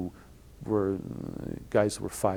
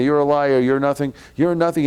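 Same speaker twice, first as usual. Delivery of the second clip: loud, raised furious shouting; yelling rabbi; 5 semitones higher, 7 dB louder than usual. Retold or imitated. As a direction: keep hammering him with the shout. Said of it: "he starts yelling at".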